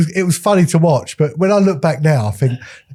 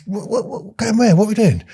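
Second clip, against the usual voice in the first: high, bewildered dad asking what's happening; baffled dad; 8 semitones higher than usual. Retold or imitated. Dad baffled by the parasailing seller, high and bewildered. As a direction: play the dad, totally lost and confused.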